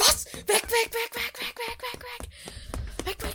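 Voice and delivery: terrified high repeated cry; crying out in fear; panicked high cries. Pretend. Fleeing in terror. (panisch hoch schreiend)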